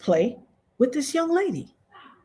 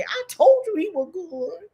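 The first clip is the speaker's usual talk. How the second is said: high, smug told-you-so; mock told-you-so; 8 semitones higher than usual. Critics now praising Shannon, high smug told-you-so. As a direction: gloat like a fair-weather fan. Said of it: "is gonna come and say".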